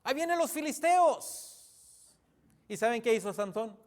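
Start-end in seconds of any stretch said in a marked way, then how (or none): 0.0-2.2 high, drawn-out warning call; acted cry of alarm. Dalila wakes sleeping Samsón as enemies come, mock alarmed cry. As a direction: call out the warning, stretching each word. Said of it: "grita y dice".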